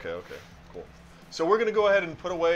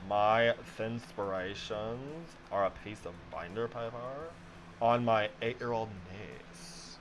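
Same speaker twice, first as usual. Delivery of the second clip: drag queen voice; 4 semitones lower, 8 dB quieter, slower than usual. Acted. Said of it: "you just did a regular drag queen voice".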